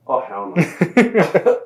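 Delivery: irate at the ending, stretching it out; imitating her own indignation; slow outraged refusal. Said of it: "I'm all like".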